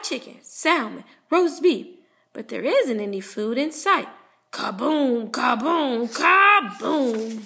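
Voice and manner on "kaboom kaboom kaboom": ordinary delivery, nothing marked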